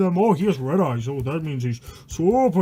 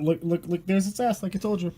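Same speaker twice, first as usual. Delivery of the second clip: usual speaking voice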